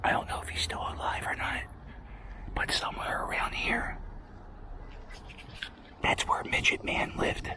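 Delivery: hushed whisper, barely any voice; secretive whisper about a neighbor. Real, not acted. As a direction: whisper it so nobody nearby hears. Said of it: "Shh, I'm gonna whisper this".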